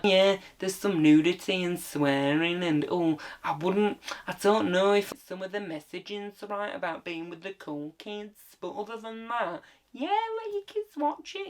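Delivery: dithering, put-on reviewer voice; prim mock reviewer, hedging; mock film reviewer. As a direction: waffle mildly, then wave it through in a high voice. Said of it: "all it says is, like".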